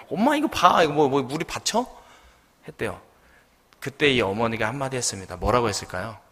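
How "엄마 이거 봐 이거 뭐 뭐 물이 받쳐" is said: high, loud gloating; triumphant son. Acted. Son shows mother the empty sieve, triumphant. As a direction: crow at mother, proving your point. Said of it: "의기양양하게".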